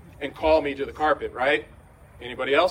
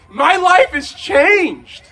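overjoyed; 9 semitones higher, 9 dB louder, much slower than usual. Retold or imitated. Overwhelmed with joy, riding home.

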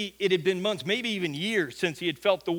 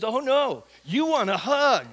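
higher, louder teasing contradiction; playful insistence; 5 semitones higher, 5 dB louder, slower than usual. Retold or imitated. Ashley insists the grumpy man wants a hug, playfully firm. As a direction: overrule them with a big "Oh, no".

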